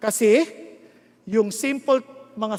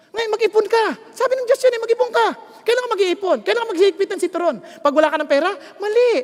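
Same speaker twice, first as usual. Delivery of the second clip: high, loud preaching push; forceful exhortation; 10 semitones higher, 7 dB louder, faster than usual. Real, not acted. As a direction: drive the point home to the crowd. (malakas na pangangaral)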